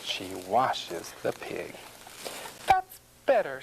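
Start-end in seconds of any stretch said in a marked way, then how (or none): 2.7-3.6 high, satisfied housewife voice; farmer's wife. A narrator's reading. Farmer's wife after washing the pig, satisfied. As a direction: pitch it high, pleased with the cleaning. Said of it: "says the farmer's wife".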